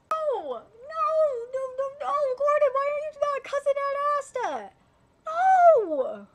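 very high, loud, strained screaming; anguished scream. Real, not acted. Fan screaming at Gordon on screen, anguished shriek. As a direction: scream at the screen in pure despair.

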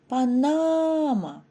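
панама with a long, high "на"; панама, slowly, leaning on the second syllable; stressed middle syllable. Shown as a demonstration. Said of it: "Вимовте з наголосом кожне слово".

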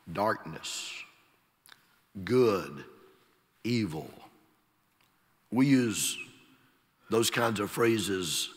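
ordinary delivery

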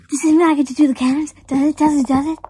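loud, high, repeated nagging; a kid begging to fire the cannons; eager pestering. Acted.

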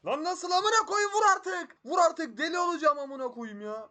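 very high, full-force furious screaming; real rage scream. Real, not acted. Gamer going crazy over hits not landing, furious high scream. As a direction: scream in frantic, exasperated rage. Rages at missed hits in game.

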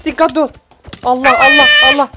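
startled high yell; panicked shouting. Real, not acted. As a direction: shriek in panic at the creeper. Creeper suddenly jumps in.

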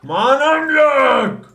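very high, slow, loud wail. Real, not acted. Wailing out of mana.

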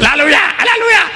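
high, fast mock hallelujah; mock Christians. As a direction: parody the excited congregation, high and fast. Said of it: "they said".

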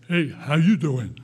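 big, slowed, booming hello; brother's loud greeting. Retold or imitated. Imitating the brother on the phone with a big loud greeting. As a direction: call out hey like the brother always did. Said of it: "my brother would call and go".

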